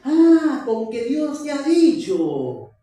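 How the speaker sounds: sly tempter greeting Eve, high and rising; voicing Satan. Preacher voicing Satan to Eve, sly and coaxing. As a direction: coax her like the serpent.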